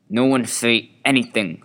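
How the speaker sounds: loud, sharp teacher snap; snapping. Acted. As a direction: cut them off hard and loud. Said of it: "snapped Miss Slinker".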